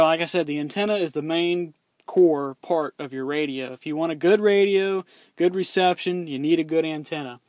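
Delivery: usual speaking voice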